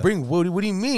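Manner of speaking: high, loud acted puzzlement; confused disbelief. Friend questions the caller's demand, acted high confusion. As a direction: stammer the question, pitched right up.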